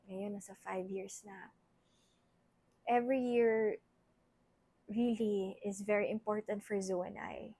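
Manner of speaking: soft, quiet heartfelt words; emotional thanks. Real, not acted. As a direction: speak softly, holding back tears. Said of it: "I don't wanna get too emotional".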